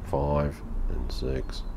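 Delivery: normal talking voice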